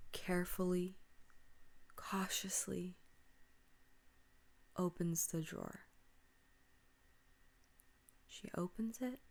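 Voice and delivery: very soft, near-whispered narration; suspenseful hush. A narrator's reading. Suspenseful moment in scary story.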